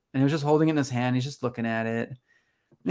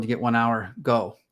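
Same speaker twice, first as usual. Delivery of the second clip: normal talking voice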